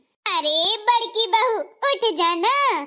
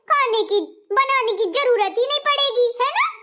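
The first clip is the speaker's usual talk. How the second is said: sulky elder bahu; 3 semitones higher than usual. Acted. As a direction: complain like a pampered bahu.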